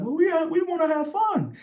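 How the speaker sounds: a very high, mocking put-on voice of a businessman; mock Halliburton voice. Mocking the exec to make him sound childish, high put-on voice. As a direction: squeak it out like a cartoon executive. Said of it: "Halliburton was like".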